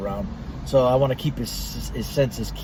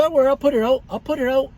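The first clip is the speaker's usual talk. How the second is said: mock appeasing; 10 semitones higher, faster than usual. Placating the security guard.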